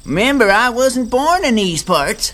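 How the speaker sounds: put-on Southern accent. Dragonfly surprises the gang with a hidden Southern accent. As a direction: turn on a thick Southern accent. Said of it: "you really do have a southern accent".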